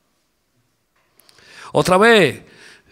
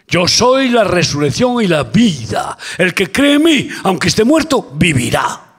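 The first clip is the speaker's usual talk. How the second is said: proclaiming scripture; 6 semitones higher, 8 dB louder than usual. Acted. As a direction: declare the words of Jesus with full force. Quotes Jesus emphatically. (proclamación lenta, fuerte y elevada)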